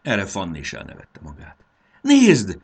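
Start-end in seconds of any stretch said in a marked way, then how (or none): 2.0-2.6 high, loud falling cry; shout. A narrator's reading. Fanny spots running cows, shouting. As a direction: call out sharply to make them look. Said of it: "kiáltott Fanny".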